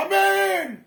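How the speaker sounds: high, loud call across a distance; yelling a name to stop someone. Retold or imitated. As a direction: shout the name after someone walking away. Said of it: "aku berteriak".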